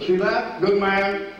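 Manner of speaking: high, sarcastic "See that? Good man"; mock praise. Lieutenant mocking a recruit who fell, high sarcastic praise. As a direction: tease the fallen recruit in a high voice.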